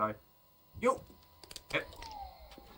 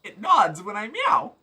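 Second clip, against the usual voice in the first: delighted; 7 semitones higher, 5 dB louder than usual. Real, not acted. Delighted by the cat.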